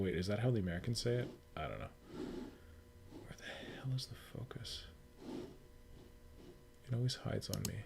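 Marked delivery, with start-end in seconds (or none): none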